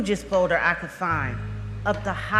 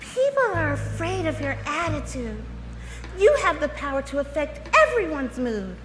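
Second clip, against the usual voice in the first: higher, put-on supervisor voice; mock disapproving manager; 5 semitones higher than usual. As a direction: imitate a boss gently scolding an employee. Voicing the managers' critical response.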